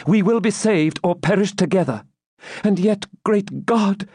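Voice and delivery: high-pitched fervent heroine; impassioned Rebecca, desperate. A narrator's reading. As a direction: vow loyalty, then turn to dread. Plays Rebecca, resolute then anguished.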